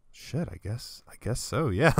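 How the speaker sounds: soft, reluctant agreement, acted out; nervous giving-in. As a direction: cave in quietly, too timid to refuse. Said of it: "too nervous to say no to".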